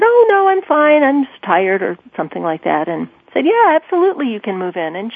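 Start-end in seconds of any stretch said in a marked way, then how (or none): 0.0-1.8 high-pitched pretend "fine", then dropping; re-enacted awkward cover-up. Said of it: "I just stammered something and said". 3.4-4.9 high, over-eager mock yes; re-enacted false enthusiasm; feigned agreement. Reluctantly agreeing, acting out false eagerness.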